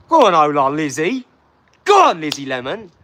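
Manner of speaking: loud call of encouragement; cheering shout. Real, not acted. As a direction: holler praise to a supporter. Cheering on a donor.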